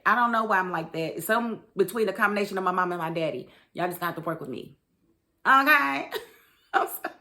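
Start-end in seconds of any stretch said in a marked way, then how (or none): none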